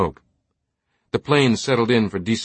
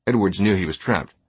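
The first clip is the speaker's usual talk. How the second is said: plain reading voice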